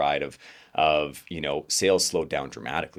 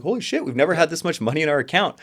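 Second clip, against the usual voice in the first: much higher, louder gleeful exclamation; elated disbelief; 11 semitones higher, 5 dB louder, faster than usual. Retold or imitated. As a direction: squeal it like you just saw the bank balance. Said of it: "extreme excitement".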